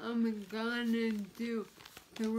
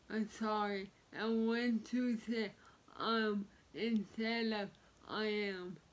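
normal talking voice

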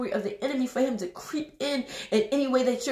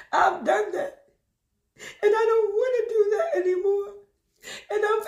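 tearful; 6 semitones higher than usual. Real, not acted. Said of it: "I'm not crying because I'm sad".

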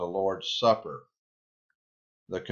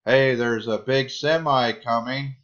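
mock warning shout, raised and louder; louder, higher pretend holler; calling out; 5 semitones higher, 5 dB louder than usual. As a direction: call out a pretend warning across a highway. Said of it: "I call out to you and say".

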